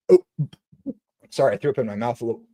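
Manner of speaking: ordinary talk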